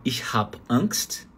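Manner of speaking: slow, careful "Ich hab Angst"; slow German demo. Shown as a demonstration. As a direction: spell out "Ich hab Angst" slowly for learners. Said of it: "Ela pode falar".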